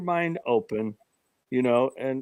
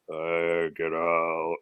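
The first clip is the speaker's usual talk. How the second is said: much lower, growled ghostly command; demonic voice; 12 semitones lower, 4 dB quieter, much slower than usual. Retold or imitated. Retelling the EVP recording in a low demonic voice. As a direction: sound like a demon on the tape. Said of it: "this most demonic voice".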